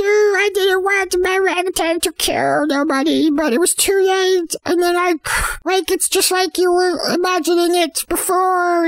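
high, plaintive confession in imitation; mock crying. Orangutan's owner confesses to the detective, mock crying. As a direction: act out a weepy, high-pitched apology. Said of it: "crying 'cause he was like".

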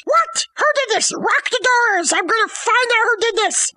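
high-pitched indignant cry; hammed-up shocked demand; mock outrage. Hamlet vows to find who did this, mock outrage.

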